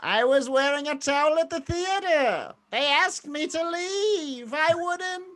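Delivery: high nasal Lincoln voice. Pretend. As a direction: speak high through the nose.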